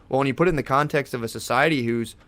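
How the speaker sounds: usual speaking voice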